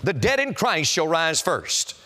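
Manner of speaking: normal talking voice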